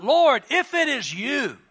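acted cry across the water. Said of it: "So he cries out".

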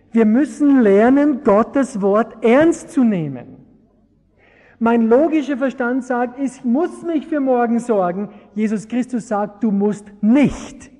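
loud, high, slow emphatic sermon voice; full-force preaching. Acted. Preacher urging the church to trust God's word, loud emphatic preaching. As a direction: hammer the point home to the congregation. Preacher drives home his point.

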